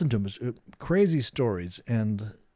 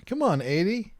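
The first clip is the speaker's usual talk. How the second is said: excited; 9 semitones higher, 4 dB louder than usual. Real, not acted. Urging the price to hit eighty.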